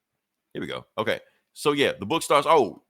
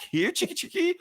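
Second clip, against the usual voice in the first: high coaxing call, like luring a hen; high mock chicken-calling. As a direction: coax the chicken out in a high sing-song. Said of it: "get a chicken out of hiding".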